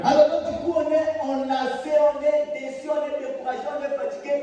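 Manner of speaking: lamenting sermon voice; fervent preaching. Real, not acted. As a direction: preach the weariness, falling away. Preacher mocks discouragement.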